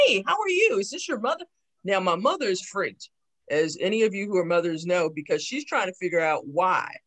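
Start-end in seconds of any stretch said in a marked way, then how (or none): 0.1-1.5 cheerful greeting. Retold or imitated. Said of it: "And she's like".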